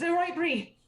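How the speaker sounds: put-on high, loud kid voice, panicking; a frantic little girl bossing her sister; panicky child. Child urging her scared sister to hide, panicking. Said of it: "The other one says".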